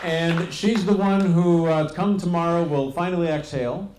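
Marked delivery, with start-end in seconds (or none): none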